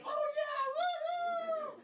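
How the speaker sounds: very high, loud whooping cheer; wild cheering. Retold or imitated. Comedians backstage celebrate another comedian's news, high loud whooping.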